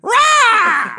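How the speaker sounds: shrieked high rah; high shouted rah. Retold or imitated. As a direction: yell Rah like a pageant queen. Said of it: "She said".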